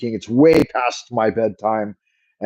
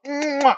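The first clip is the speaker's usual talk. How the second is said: blown kiss; 4 dB quieter than usual. Real, not acted. Blowing a kiss goodbye.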